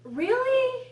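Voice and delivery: high, loud disbelieving "Really?"; a sky-high, loud "Really?" of mock outrage; incredulous really. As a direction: scoff at her in disbelief. Mocking the whiny girl.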